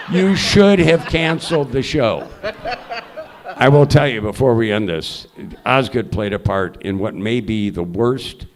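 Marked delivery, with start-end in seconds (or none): 0.1-2.3 1 laughing retort. Retold or imitated.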